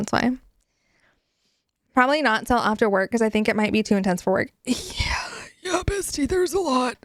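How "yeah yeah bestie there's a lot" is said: whisper. Pretend. Whispered warning about the book.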